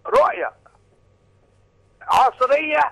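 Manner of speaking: loud, high caller shouting praise; shouting over a phone line. Real, not acted.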